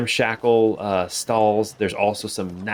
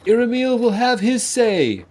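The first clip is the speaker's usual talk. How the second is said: higher, far-carrying proclamation; street preacher; 12 semitones higher than usual. Acted. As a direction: cry it out like a doomsayer at market. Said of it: "You hear calling out".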